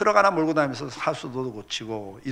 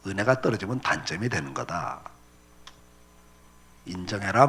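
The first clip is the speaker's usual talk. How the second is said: voice of the Lord; 7 semitones lower, 6 dB quieter than usual. Retold or imitated. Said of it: "새벽에 기도하는데 주님께서".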